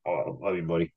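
soft tender good night. Real, not acted. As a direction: say it softly with love.

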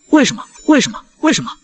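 high-pitched, repeated accusing cries; agitated demanding. Acted. Wife blaming husband's success, agitated high-pitched demanding.

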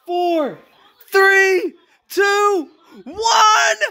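countdown rising to a shout; new year countdown crowd. Real, not acted. Counting down to midnight, building.

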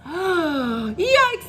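high storybook yelp of alarm; mild mock screech. A narrator's reading. Monkeys nearing the waterfall, mock screech. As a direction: yelp it, holding back the volume. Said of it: "they all screeched".

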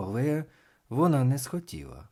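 ordinary delivery, nothing marked

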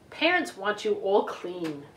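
plain reading voice

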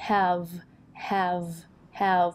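"have" said fully with an open a vowel; full-vowel have. Shown as a demonstration. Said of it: "ปกติเราจะออกเสียงแบบสระแอะ". (ออกเสียง have เต็มเสียงสระแอะ)

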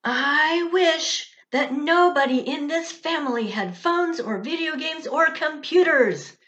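put-on high, loud childish shout; child shouting at her whole family. A narrator's reading. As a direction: shout it out in frustration. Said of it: "shouted Ella".

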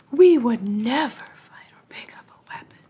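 hushed fairy whisper. Acted. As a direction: whisper it like a sacred vow. Fernweaver whispers her pacifism.

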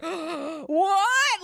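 high, loud drawn-out disbelief; freaking-out shock. Acted. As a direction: cry out like a scandalised crowd. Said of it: "they are freaking out".

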